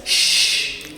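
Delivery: a long voiceless "Sh", as for quiet; hushing sh. Shown as a demonstration. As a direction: hold "Sh" as a steady hush. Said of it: "you can say sh to be quiet".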